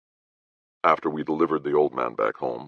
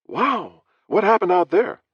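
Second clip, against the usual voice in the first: surprised; 4 dB louder than usual. Acted. Jane asks surprised.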